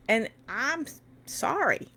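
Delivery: high, emotional apology; apologise, pitch climbing with real emotion. Real, not acted. Livestreamer apologising for getting worked up, emotional. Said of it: "I'm very emotional right now".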